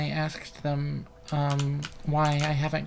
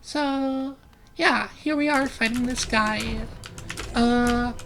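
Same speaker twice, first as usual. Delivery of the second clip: higher-pitched practised voice; put-on higher voice; 7 semitones higher than usual. Shown as a demonstration. Streamer with voice dysphoria narrating the game, pitched up. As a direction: hold your voice up in a higher register. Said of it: "try to make my voice sound like this".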